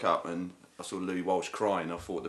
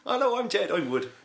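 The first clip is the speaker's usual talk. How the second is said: squeaky high voice; high mock voice; 12 semitones higher, 3 dB louder than usual. Voicing [name] joke.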